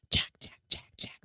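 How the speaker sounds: unvoiced gleeful whispering of the name; delighted hiss. A narrator's reading. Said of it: "the voice hissed delightedly".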